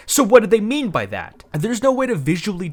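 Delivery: loud, high, fed-up question; exasperated outburst. Real, not acted. Reviewer fed up with the booklet, loud exasperated question.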